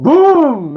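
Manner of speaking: loud high boom shout; explosive boom. Retold or imitated. Sound effect of explosion.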